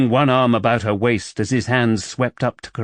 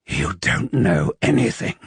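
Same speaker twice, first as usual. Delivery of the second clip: rasped; 4 semitones lower than usual. A narrator's reading. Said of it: "he rasped".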